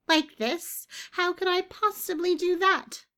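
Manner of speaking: high, indignant outburst; incensed. A narrator's reading. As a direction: make it sound outrageous, way up high. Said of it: "The other was incensed".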